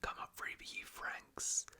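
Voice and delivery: normal talking voice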